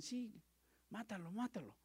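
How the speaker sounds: lightly voiced crowd yell; crowd shout. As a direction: cry it like the mob. Crowd shouting to kill Jesus.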